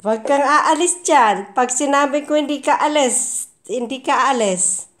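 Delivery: loud stern orders to the pet; loud mock command. Owner loudly ordering her cat to stay put.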